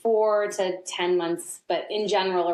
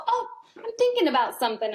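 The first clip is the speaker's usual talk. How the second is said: put-on light, quick, higher toddler voice; toddler's voice; 7 semitones higher, faster than usual. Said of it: "he'll go".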